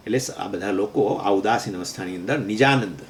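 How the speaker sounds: usual speaking voice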